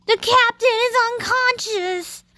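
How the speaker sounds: high, urgent announcer cry; dramatic alarm. Acted. Gamer copying an in-game line, alarmed. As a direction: sound the alarm like the game's voice. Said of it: "in that exact voice".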